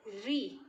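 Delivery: री with a long ee vowel; correct "ree" sound. Shown as a demonstration. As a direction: say री as a firm "ree". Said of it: "इसका उच्चारण कैसे होगा". ("री" को साफ़ लंबी ई से बोलें)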